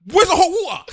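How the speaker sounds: high, loud, laughing demand; retold boy loudly demanding hot water; mock indignant. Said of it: "I said".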